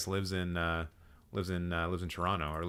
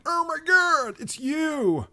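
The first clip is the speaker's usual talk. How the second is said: very high, loud squeal; squealing fan; 7 dB louder, much slower than usual. Retold or imitated. Random fans mobbing a celebrity, squealing. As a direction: shriek it like a starstruck fan. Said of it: "being like".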